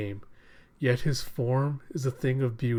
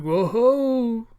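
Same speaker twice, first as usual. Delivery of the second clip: higher, louder whoop of amazement; excited whoop; 9 semitones higher, 5 dB louder than usual. Retold or imitated. Bakura reacting to the ace's spike, excited whoop. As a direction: cheer at the big spike.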